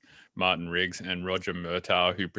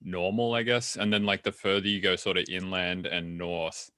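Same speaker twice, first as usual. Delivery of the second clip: ordinary talk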